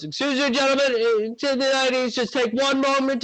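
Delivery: high, courteous pleading voice; polite crewmate. Acted. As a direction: ask the crowd politely in a high voice.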